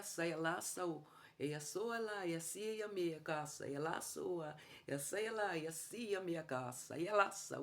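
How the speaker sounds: loud, high, forceful glossolalia chant; speaking in tongues. Shown as a demonstration. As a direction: pour out the tongues with authority, full voice. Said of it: "Eu começo a falar assim".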